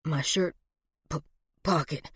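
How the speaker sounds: low, halting, stammered words; panting, weak. A narrator's reading. Wounded man directing her to his pocket, weak and halting. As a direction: struggle to get the words out. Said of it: "He was panting now".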